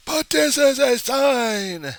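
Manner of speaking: slow, high, loud wail; frantic wail. Acted. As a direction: draw it out, high and hysterical. Mock freakout over the sign.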